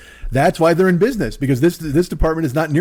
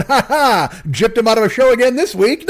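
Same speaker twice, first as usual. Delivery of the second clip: higher, mock gloating chuckle of a cigar-smoking tycoon; gloating rich tycoon; 6 semitones higher than usual. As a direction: play a smug fat cat laughing at his scam. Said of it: "like the Monopoly guy, going".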